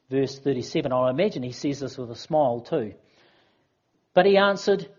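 ordinary talk

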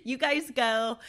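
laughing refusal. Retold or imitated. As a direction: giggle it out while waving them off. Laughing through the remembered refusal.

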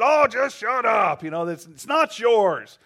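loud, high, slow exasperated yell; mock angry shout at the gulls; fed-up shout. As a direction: snap at the birds to shut up. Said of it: "finally somebody said".

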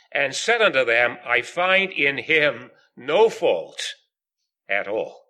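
emphatic Bible reading with weighty pauses; scripture reading. Acted. Preacher reading Pilate's words to the meeting, deliberate scripture reading. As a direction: read it out firmly, lingering on the key phrases. Reads scripture aloud to congregation.

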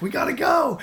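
frantic agreement. Retold or imitated. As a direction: squeal it in alarm.

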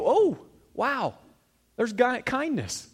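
high-pitched acted wonder; amazed discovery. Preacher acting out finding kindness while walking, amazed. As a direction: stumble on it with a rising wow.

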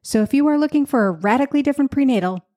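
upbeat sponsor read, smooth and polished. Acted. Host reading a sponsor ad to listeners, upbeat. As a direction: sell the product warmly to listeners.

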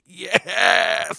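hammed-up triumphant yell; gleeful cheer. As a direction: celebrate with a drawn-out shout. Acts out his gleeful reaction.

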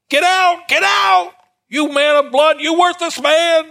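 high, loud South Carolina shout of a raving man; South Carolina accent. Acted. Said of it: "it said in a good South Carolina accent".

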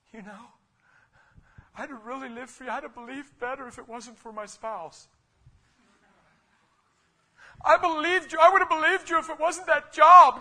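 hammed-up whining excuses to God; whiny excuse-maker. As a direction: plead your excuses like a sulky believer. Believer's excuses before Jesus, mock whine.

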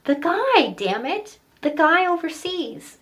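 loud, high exasperated outburst, acted out; frustrated child. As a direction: play a kid at wit's end, shouting wrong guesses. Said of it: "And I got so frustrated".

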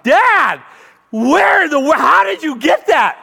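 high, loud, amazed yelling; excited shout. Retold or imitated. Son shouting at his dad over the signed baseball, amazed and loud. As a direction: burst out like a thrilled kid.

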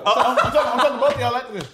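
laughing. Real, not acted. Laughing mock threat.

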